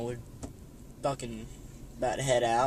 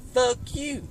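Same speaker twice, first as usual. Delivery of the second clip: much higher, punchy put-on refusal; mock refusal; 10 semitones higher than usual. Telling buddy off about the tree, high cheeky refusal. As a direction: fling it back high and cheeky. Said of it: "I'm like".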